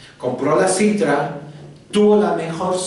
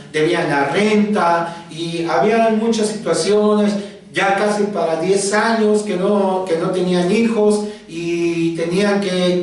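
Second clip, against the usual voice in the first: ordinary talk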